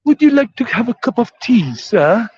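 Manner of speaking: high-pitched put-on British accent. Shown as a demonstration. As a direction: politely offer tea in a mock British accent. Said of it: "let me see, British".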